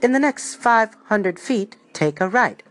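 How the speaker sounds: slow, smoother Siri-style voice with a bad British accent; badly faked British GPS assistant; terrible British accent. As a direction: do a hammy British Siri giving directions, unhurried. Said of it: "That was a terrible British accent".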